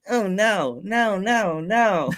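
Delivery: high, wavering mock dismay; Val. Host acting out Val in cartoon intro, mock dismay. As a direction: swoop up and down on each "no". Acts out Val's reaction in cartoon.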